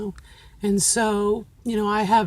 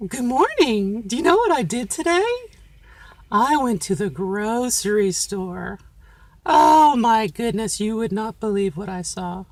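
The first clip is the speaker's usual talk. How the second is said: chatty and animated, ride the melody; animated sing-song storytelling; lively feminine pattern; 4 dB louder than usual. Shown as a demonstration. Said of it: "this is gonna sound very different".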